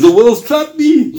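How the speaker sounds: high, drawn-out acted wail; tearful outcry. Retelling a companion's tearful answer about the world, high anguished cry. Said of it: "he burst into tears".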